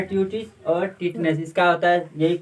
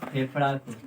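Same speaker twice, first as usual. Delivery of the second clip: फेफड़ा को said low and soft as the answer; modelled answer; 5 semitones lower, 6 dB quieter than usual. Shown as a demonstration. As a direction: confirm फेफड़ा को quietly, stress on फेफड़ा. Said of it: "किसको करता है?".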